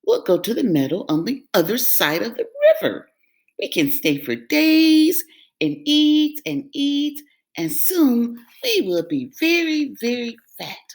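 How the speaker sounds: high, quick, pleased goat voice; proud of the plan; little goat sure of himself; clever little goat. Acted. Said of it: "feeling very smart".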